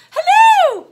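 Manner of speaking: very high, loud telephone hello; loud, squeaky-high operator greeting; unusually high-pitched. A narrator's reading. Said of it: "came in an unusually high-pitched tone".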